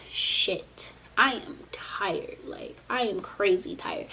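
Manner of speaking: whispered, worn out; whispering. Real, not acted. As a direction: breathe it out in a tired whisper. Whispered exhaustion.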